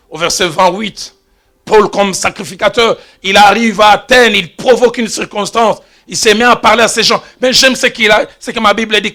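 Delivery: usual speaking voice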